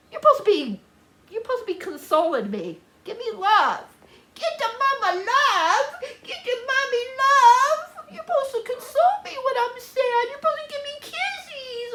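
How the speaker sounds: singsong plea in a high pet-talk voice. Real, not acted. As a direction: playfully beg your dog for love. Coaxing her pet for affection.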